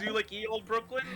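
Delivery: old-timey Brooklyn accent, hammed-up; a hint of Brooklyn accent. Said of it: "Do your best Brooklyn accent".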